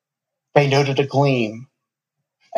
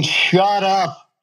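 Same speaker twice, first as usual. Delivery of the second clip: sharp snapped shut up; snapping; 6 semitones higher than usual. A narrator's reading. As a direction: cut him off sharply. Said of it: "Faye snapped".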